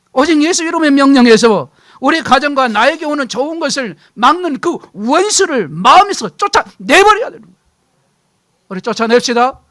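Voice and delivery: preacher building to full force; fiery preaching. Acted. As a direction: thunder the list of sins.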